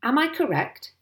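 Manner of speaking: officious query; officious sergeant. Acted. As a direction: check it like a self-important policeman. Sergeant questions the angry landowner.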